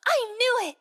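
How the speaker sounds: gasp, then a loud, high, triumphant I knew it; excited gasp. Acted. Friend to Annie, delighted she guessed the crush, excited. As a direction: catch her out and crow about it.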